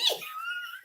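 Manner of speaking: shrieking laugh breaks out; high burst of laughter. Real, not acted. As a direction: laugh high at the absurd memory. Laughing at the memory.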